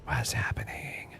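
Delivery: slow, very soft whisper; hushed whisper. Real, not acted. Streamer reacting to the game, hushed whisper. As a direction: whisper it under your breath, drawn out.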